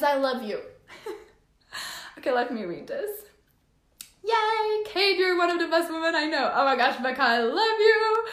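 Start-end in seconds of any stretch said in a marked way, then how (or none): 4.3-8.3 high, loud squeal of delight; thrilled, high-pitched outpouring; overjoyed. Real, not acted. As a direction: gush with real joy, voice soaring. Overjoyed thanks to viewers.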